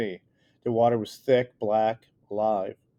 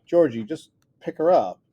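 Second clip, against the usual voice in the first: urgent call; 5 semitones higher, 6 dB louder than usual. A narrator's reading. Urgent plea during flood.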